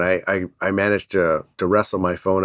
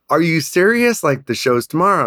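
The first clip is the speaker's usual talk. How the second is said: high-pitched retold disbelief; incredulous wife; 8 semitones higher, 3 dB louder than usual. Wife to husband the day before the show, incredulous. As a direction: question him in a raised, mock-incredulous voice.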